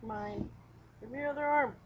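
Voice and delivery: ordinary talk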